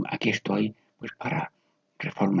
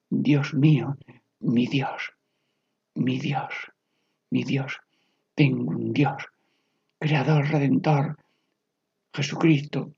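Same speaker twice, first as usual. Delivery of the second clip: meditative prayer; 4 semitones higher than usual. Real, not acted. Leading listeners through Ignatian prayer word by word, meditative prayer.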